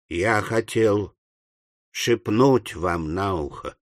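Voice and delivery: low, slow, soft voice; soft, hesitant murmur; hushed confiding. Acted. Boy confides in Pickwick.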